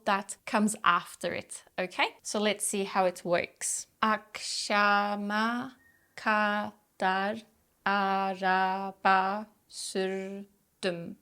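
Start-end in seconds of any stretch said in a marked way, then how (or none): 4.0-11.2 "Akşama kadar araba sürdüm" very slow, each syllable separate. Shown as a demonstration. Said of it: "reading this entire sentence syllable by syllable".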